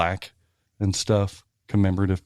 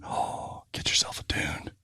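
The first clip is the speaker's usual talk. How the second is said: whisper; 12 dB quieter, slower than usual. Acted. Hushed sales pitch.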